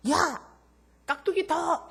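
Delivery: loud, high rude order; arrogant customer barking. Retold or imitated. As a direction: bark the order like a boss. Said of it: "거만하게 생긴 사람이 반말을 찍찍하면서".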